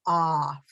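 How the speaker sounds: Off said clearly with an open ah; model word. Shown as a demonstration. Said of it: "how my mouth is open when I say off".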